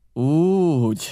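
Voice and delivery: drawn-out exclamation; exclaiming. A narrator's reading. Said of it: "kiáltott föl Silver".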